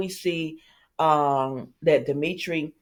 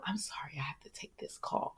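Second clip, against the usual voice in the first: whispered aside; 15 dB quieter than usual. Retold or imitated. Retold phone-call aside.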